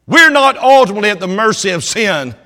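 loud, high, rousing preacher's climb; full-force preaching. Acted. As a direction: lift the crowd up with fervour.

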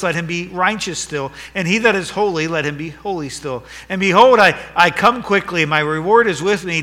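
ringing pulpit proclamation; preaching at full force. Acted. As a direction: declare it to the whole church.

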